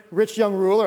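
ordinary talk, nothing marked

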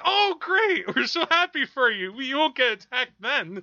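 high, loud cheery parents. Retold or imitated. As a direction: gush like proud parents. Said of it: "And they're like".

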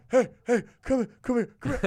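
loud urgent beckoning; calling out. Retold or imitated. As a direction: wave them over, loud. Don calling from window.

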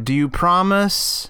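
ordinary talk, nothing marked

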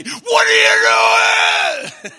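loud, slow furious coach bellow; angry coach yell. Retold or imitated. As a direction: yell at the team after a bad play. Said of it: "my dad is the football coach when we made a bad play".

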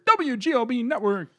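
high, slow, drawn-out announcer call. Acted. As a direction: boom the network name like an announcer. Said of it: "I went to full announcer voice here".

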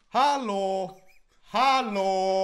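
put-on loud barking shout of an angry old man in the cinema; angry old man shouting hallo at a phone; shouting hallå. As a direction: yell it across the cinema row.

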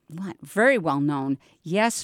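usual speaking voice

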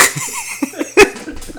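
breathless high giggle; high wheezing laugh. Real, not acted. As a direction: laugh silently, high and airy. Both laugh at Arabic mixup.

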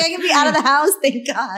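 high, loud overjoyed release; mock overjoyed shout of relief; excited relief. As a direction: burst out like freed from lockdown. Imagining post-pandemic relief.

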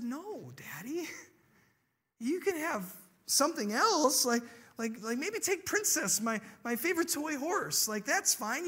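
put-on high, pleading child's voice; confused little girl. Five-year-old offers her dad a swap, confused. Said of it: "And she was confused".

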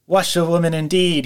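loud scornful cry; wild scornful shout; reckless shout. A narrator's reading. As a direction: fling it out recklessly. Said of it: "he shouted recklessly".

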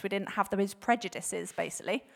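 ordinary delivery

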